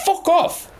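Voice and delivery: high, exasperated snap; indignant fuck off. Real, not acted. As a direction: swat the idea away. Dismissing cheeky teams.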